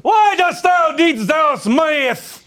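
slow, grand lordly proclamation; Shakespeare verse. Acted. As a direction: lord scorning peasants, drawn out. Lord addresses peasants, archaic.

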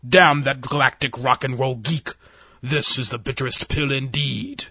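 angry admiral. Acted. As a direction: curse it in bitter fury. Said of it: "the Admiral roared".

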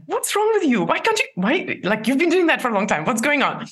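high, exasperated self-scolding; frustrated inner critic, pitched high. Retold or imitated. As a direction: snap at yourself for losing balance. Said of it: "I would start at myself, like".

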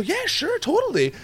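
very high, soft, keen yes; ready agreement. Retold or imitated. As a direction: imitate strangers happily agreeing. Retold strangers readily agree.

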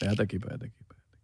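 susurro muy suave, casi inaudible; susurro cercano. Pretend. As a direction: susurra pegado al micrófono. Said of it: "yo creo que tú hables así cerca". (cuchicheo íntimo de broma)

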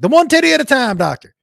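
put-on high, loud Southern-accented ad pitch; rally the crowd like a Southern TV doctor; loud, hammed-up Southern pitchman. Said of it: "that doctor with a Southern accent".

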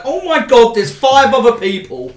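high, loud panicked outburst; panicked cry. Acted. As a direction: blurt it out in sudden panic. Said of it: "He's like".

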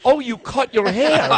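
the lady's line again, high and slow through laughter; laughing through quote. Retold or imitated. Host retelling the religious lady again, laughing. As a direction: repeat the lady's words, still laughing. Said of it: "She goes".

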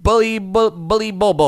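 high, silly singsong babble; playful nickname riff. Pretend. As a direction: toy with the syllables in a high voice.

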